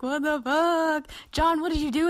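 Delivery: acting out startled friends; shocked disbelief. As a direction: retell the group's alarmed reaction. Retold group shock at photo.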